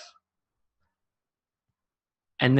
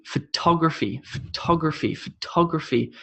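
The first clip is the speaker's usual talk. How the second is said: photography as pho-TOG-raphy, falling; emphasize TOG in photography; photography stressed on the second syllable; 4 dB quieter than usual. Shown as a demonstration. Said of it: "I'm emphasizing the ta".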